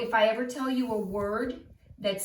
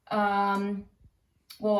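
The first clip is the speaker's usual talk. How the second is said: ordinary talk, nothing marked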